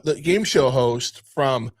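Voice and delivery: ordinary talk, nothing marked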